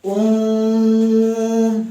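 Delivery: ओम held long and steady, the vowel stretched to three beats; long drawn-out Om. Shown as a demonstration. Teacher showing pupils a three-beat vowel, drawn-out ओम. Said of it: "यहाँ पर काफी समय लगेगा".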